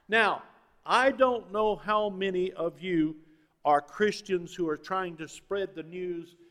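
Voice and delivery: loud, emphatic preacher; forceful preaching. Acted. Pastor challenging gloomy Christians, forceful preaching. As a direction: preach it hard to the room.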